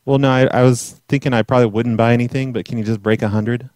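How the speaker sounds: ordinary delivery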